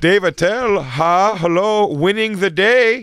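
high, stretched mock Indian accent; hammed-up high Indian accent. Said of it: "with an accent like that".

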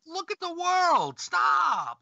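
exasperated yell. Real, not acted. Exasperated at people.

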